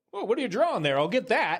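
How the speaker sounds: high breezy offhand voice; carefree guy voice. Retold or imitated. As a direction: toss it off like nothing matters. Imitating own impulsive self.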